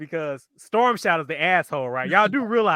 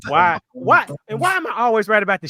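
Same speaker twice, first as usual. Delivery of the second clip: exasperated why; 5 semitones higher, 5 dB louder than usual. Real, not acted. Exasperated complaint.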